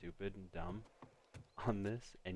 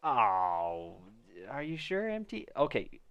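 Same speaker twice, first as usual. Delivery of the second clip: incredulous question to a viewer; surprised; 2 semitones higher, 10 dB louder, slower than usual. Real, not acted.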